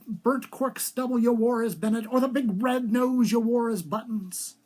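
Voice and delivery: plain reading voice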